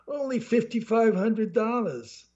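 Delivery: high, slow squeal on the price; voice rising high. A narrator's reading. Salesman slashing the price, voice climbing high. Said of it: "his voice rose two octaves higher".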